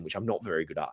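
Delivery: normal talking voice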